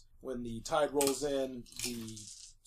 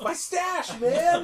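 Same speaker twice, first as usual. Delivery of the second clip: much higher, laughing stoner whine; 13 semitones higher, 5 dB louder, much slower than usual. Retold or imitated. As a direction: whine about the stash. Player voicing Scooter.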